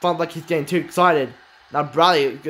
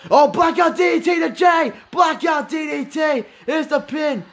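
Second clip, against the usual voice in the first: excited shout; 8 semitones higher than usual. Real, not acted. Commentator yelling at the blackout DDT, excited shout. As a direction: shout the move name as it hits.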